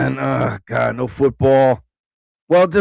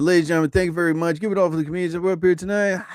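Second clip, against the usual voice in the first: rushed MC outro; 3 semitones higher, much faster than usual. Retold or imitated. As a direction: race through the MC outro. Rushed MC wrap-up.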